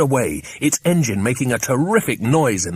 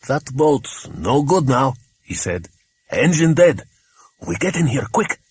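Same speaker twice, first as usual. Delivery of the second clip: Andros voice; 4 semitones higher than usual. A narrator's reading. Andros speaking, accented.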